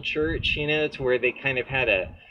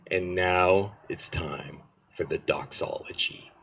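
Jack Retzer's voice; 6 semitones lower than usual. Retold or imitated. Recalling the pastor's weekly announcement, low solemn imitation. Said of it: "he had this voice like this".

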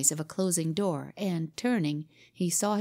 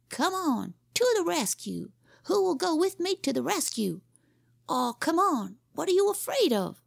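put-on much higher boyish call to arms; rallying boy captain; 11 semitones higher than usual. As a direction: whip the gang up, pleading and daring. Leader calls for rescue.

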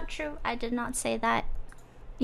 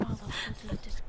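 whispering; 9 dB quieter, faster than usual. Pretend. Whispering joke about sleeping mom.

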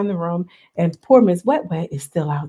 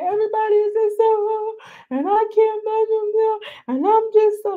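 much higher, whiny, put-on voice; mock whining Wet Wet; 14 semitones higher than usual. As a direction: whine like the upset cast member. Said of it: "She outside".

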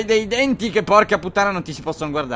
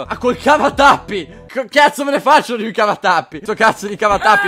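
surprised outrage; 4 semitones higher, 6 dB louder than usual. Real, not acted. Finds corkscrew inside patient.

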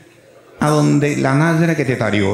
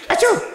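mock sneeze. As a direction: sneeze it out big. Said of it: "when you make achí".

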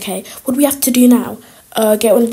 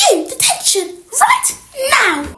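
higher, stern teacher order; strict teacher; 9 semitones higher, slower than usual. Acted. Teacher snaps detention at a cheeky pupil. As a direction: slap her with a detention.

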